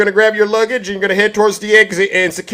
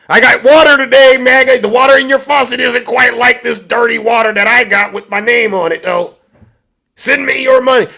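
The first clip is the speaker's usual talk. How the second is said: higher, lightly hammed-up huckster; mock sales pitch; 4 semitones higher, 5 dB louder than usual. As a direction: pitch it like a carnival seller. Mock Trump hawking bottled water.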